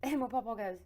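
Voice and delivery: ordinary talk, nothing marked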